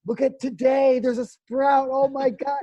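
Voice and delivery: high, loud shout of discovery; overjoyed, hammed-up exclaiming; acted excitement. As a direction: burst out in thrilled amazement. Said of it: "It's the excitement of knowing".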